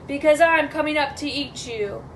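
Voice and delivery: troll roar, easing off; roaring troll threat. Acted. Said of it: "roared the troll".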